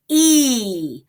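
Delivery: long E sound, held out clearly. Shown as a demonstration. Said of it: "So you would hear".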